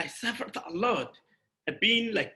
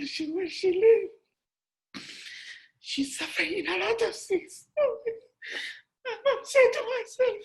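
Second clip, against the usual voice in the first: tearful. Real, not acted. Emotional memory of mother's suffering.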